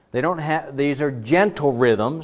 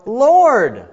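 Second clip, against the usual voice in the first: higher, pleading question; anguished question to God; 10 semitones higher, 8 dB louder than usual. Shown as a demonstration. Psalmist questioning God, higher and pleading. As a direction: ask God in bewilderment.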